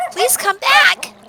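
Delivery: high, loud, slow desperate call; pleading puppy. Acted. Dog calling her friend back from shore, pleading.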